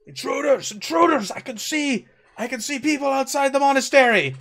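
put-on high, loud alarm shout in German accent; German voice crying out. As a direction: yell the alarm like a German guard. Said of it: "a German voice crying out".

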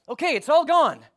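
high, loud acted outburst; mock exasperation. Acting out Toad's frustration.

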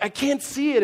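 high, loud retold exasperation; hammed-up frustrated whine; mock frustration. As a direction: protest in playful exasperation. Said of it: "now I'm getting frustrated".

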